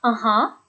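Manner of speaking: "Uh-huh" climbing at the end; engaged, upturned "Uh-huh"; rising intonation. Shown as a demonstration. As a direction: lift the second syllable of "Uh-huh". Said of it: "That goes up at the end as well".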